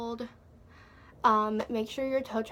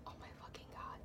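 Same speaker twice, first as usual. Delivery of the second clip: barely-there horrified whisper; stunned whisper; 20 dB quieter, faster than usual. Retold or imitated. Hearing the man start yelling, stunned whisper. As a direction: whisper it under your breath.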